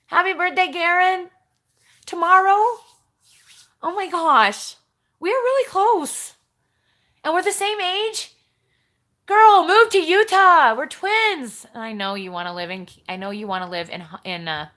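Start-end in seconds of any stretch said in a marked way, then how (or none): 0.1-11.7 high and loud, gushing; squealing with glee at a shared birthday; thrilled. Real, not acted.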